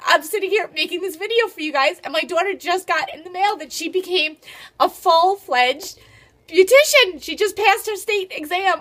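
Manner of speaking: crying from pride while still talking; mother in tears of joy over her daughter's exam; happy tears. Real, not acted. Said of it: "I'm gonna cry".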